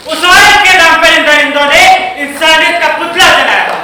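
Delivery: loud, impassioned declamation; society's outcry. Acted. Society denouncing the men's cruelty, loud outcry. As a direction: cry it out as a public denunciation.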